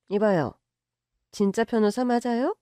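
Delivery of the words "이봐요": usual speaking voice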